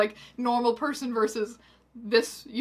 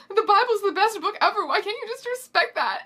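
high, loud mock sobs; hammed-up tearful wail; feminine sobbing; 10 semitones higher, 5 dB louder than usual. A narrator's reading. Hermione upset at the unbeliever, feminine mock sobbing. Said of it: "Hermione sobbed femininely".

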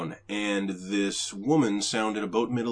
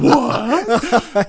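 loud, higher, laughing; shocked what; 14 dB louder than usual. Retold or imitated. Colleagues reacting to the hang-up, laughing shock. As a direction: blurt it out in mock horror. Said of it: "they're all like".

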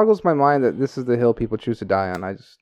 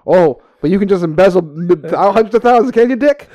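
louder, rising mock retort, up high by the end; sarcastic comeback; 3 semitones higher, 5 dB louder than usual. An imagined comeback to Dave about benefit money, louder and rising. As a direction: jab back at him with rising scorn. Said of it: "I'm gonna be like".

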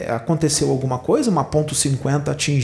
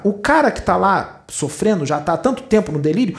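normal talking voice

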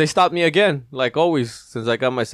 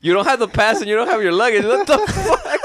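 high, loud, laughing; laughing at the confused traveller; laughing disbelief; 9 semitones higher, 4 dB louder than usual. Real, not acted. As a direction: break into laughter on it. Laughing retelling of absurdity.

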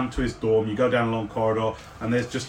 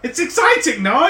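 high, louder, breathless with wonder; excited NPC; 12 semitones higher, 6 dB louder than usual. Acted. Artificer telling player about the mark, excited and louder. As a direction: gush about the rare mark.

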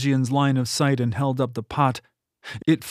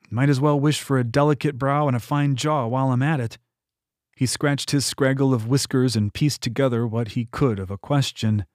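ordinary delivery

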